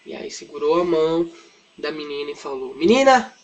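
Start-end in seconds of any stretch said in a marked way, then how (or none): 2.7-3.3 loud, high acted call; commanding call. Jesus calling the dead girl to rise, commanding call. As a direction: call out to rouse her.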